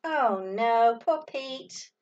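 usual speaking voice

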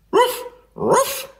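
high, insistent double yap; squeaky, emphatic ruff ruff. A narrator's reading. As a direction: bark urgently like a small dog. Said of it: "even louder".

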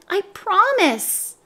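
high, slow, reassuring creature; drawn-out high little tempter; pigtail's little voice. A narrator's reading. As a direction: coax him in a small voice. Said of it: "said the pigtail".

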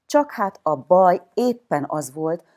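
ordinary delivery

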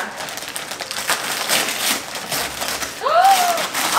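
long delighted gasp; surprised gasp. Real, not acted. Opening a gift from Santa, surprised gasp.

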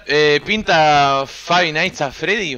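drawn-out announcement. Acted. Streamer proposes the game to chat.

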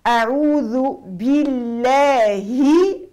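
تلاوة مجودة بطيئة; slow recitation. Shown as a demonstration. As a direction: recite each letter its full time. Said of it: "teacher demonstrating recitation".

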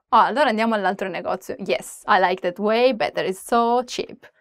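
ordinary talk

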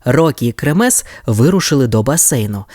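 ordinary delivery, nothing marked

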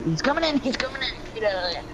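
high, urgent alarm; urgent warning. Real, not acted. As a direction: warn them fast, high.

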